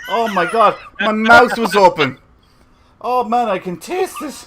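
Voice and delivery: loud, high, grossed out; disgusted yelling. Real, not acted. Cat farts during live show.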